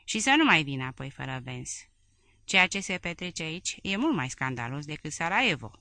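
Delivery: usual speaking voice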